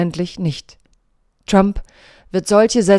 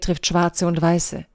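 ordinary talk

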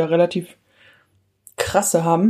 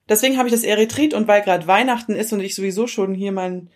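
ordinary delivery

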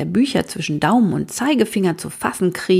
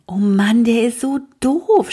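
groaning; 6 semitones higher, 4 dB louder than usual. A narrator's reading. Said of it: "stöhnt Vera".

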